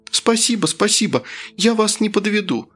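high-pitched, delighted thanks; effusively grateful Kira. A narrator's reading. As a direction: gush with thanks in a high girl's voice. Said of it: "рассыпалась в благодарностях Кира". (восторженная благодарность высоким девичьим голосом)

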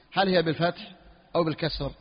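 ordinary talk

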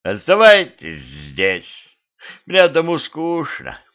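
slow, stretched skeleton drawl. Acted. Lonely skeleton invites travellers to stay, drawling. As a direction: draw the words out with swooping pitch. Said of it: "протянул скелет".

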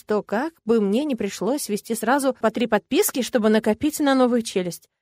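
plain reading voice

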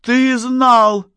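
high, loud accusing scream; full-voice yell. A narrator's reading. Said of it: "заорала она уже в полный голос". (обвиняющий крик во весь голос)

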